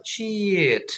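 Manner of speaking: SHEET held on a long falling ee; long vowel. Shown as a demonstration. Teacher insisting it must be long, stretched high SHEET. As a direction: draw out SHEET. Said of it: "Harus panjang".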